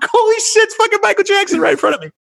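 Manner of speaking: very high, loud and fast, through a laugh; starstruck disbelief. Retold or imitated. Fan whispering awe beside [name], giddy and high.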